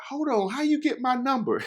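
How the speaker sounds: high-pitched, startled imitation of her; surprised girlfriend. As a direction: squeak it out like she's caught off guard. Voicing Kennedy surprised by call.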